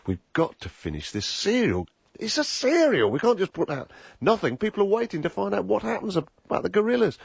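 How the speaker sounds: high-pitched, rising exasperated fretting; retold panic. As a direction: plead with mounting alarm. Thinking in panic over unfinished serial.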